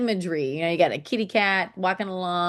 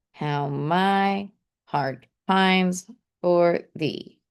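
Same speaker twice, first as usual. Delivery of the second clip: slow, each beat marked out; slow sing-song beat reading; stressed reading; much slower than usual. Shown as a demonstration. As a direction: read it slowly, leaning on the stresses. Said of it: "and I'm gonna show you".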